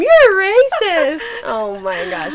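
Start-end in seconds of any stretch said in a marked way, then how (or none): 0.0-1.2 high, drawn-out mock scream; hammed-up cry of outrage; imagined scream. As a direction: stretch it out like an outraged accuser. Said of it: "how many people would scream".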